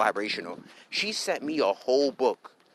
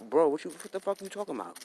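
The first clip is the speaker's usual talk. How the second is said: exasperated disbelief; 5 semitones higher, 3 dB quieter than usual. Retold or imitated. Acted exasperated reply to her texts.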